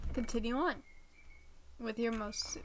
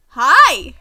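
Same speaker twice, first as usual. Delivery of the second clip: big shouted hi; loud greeting; 2 semitones higher, 23 dB louder than usual. Real, not acted. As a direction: call out hi to the villager. Greeting a Minecraft villager.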